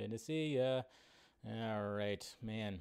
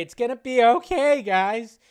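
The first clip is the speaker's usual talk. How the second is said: much higher, louder, hammed-up comfort; mock reassurance; 8 semitones higher, 11 dB louder than usual. Telling the sighing chat it will be fine in a loud, high mock-comfort. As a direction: overplay the soothing for laughs.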